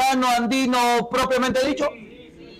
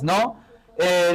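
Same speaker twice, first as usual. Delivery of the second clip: normal talking voice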